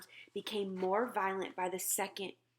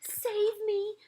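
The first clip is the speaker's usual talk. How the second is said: high, loud cry for help; terrified scream; 14 semitones higher, 6 dB louder than usual. A narrator's reading. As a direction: scream to be rescued. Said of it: "screamed the flesh lump eater".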